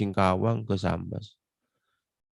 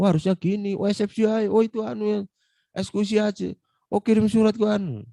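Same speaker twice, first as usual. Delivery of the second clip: eager advice; 10 semitones higher than usual. Retold or imitated. Quoting his advice to senior.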